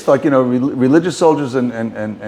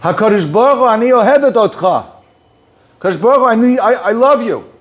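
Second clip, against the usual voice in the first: retold outcry to God; 8 semitones higher than usual. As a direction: call out to God suddenly, as if overcome. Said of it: "she screamed out".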